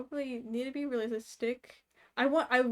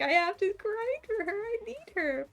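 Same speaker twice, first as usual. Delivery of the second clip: desperate mock plea; 10 semitones higher, 4 dB louder than usual. Retelling her desperate gacha thoughts.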